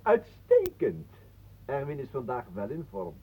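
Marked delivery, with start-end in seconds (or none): 0.0-1.1 drawn-out praise. Acted.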